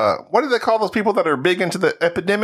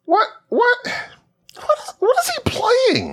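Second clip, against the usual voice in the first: high, strained cry of disbelief; a shrill what-is-he-playing squeal; shrieking disbelief. Real, not acted. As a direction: squeal in mock horror at the list. Shocked at weird game list.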